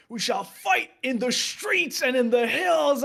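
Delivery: slow, booming, hammed-up rallying cry; screamed Churchill speech. Shown as a demonstration. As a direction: belt it out like a rousing Churchill speech. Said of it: "It's just fucking screaming it out".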